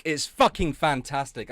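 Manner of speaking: usual speaking voice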